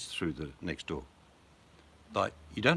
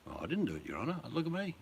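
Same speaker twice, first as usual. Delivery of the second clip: mock-innocent plea, rising higher; innocent old bloke; 8 semitones higher, 4 dB quieter, faster than usual. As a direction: plead innocence to the judge. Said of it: "how are they gonna take an old bloke to court".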